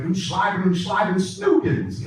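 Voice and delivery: high-pitched mock-German Hitler gibberish; yelly Hitler impression. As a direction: imitate a friend's silly Hitler impression. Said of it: "I think I got a Hitler down".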